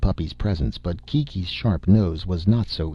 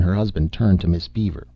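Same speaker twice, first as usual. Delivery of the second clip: ordinary delivery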